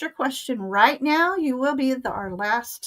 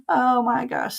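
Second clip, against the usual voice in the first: usual speaking voice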